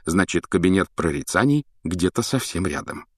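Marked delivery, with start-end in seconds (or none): none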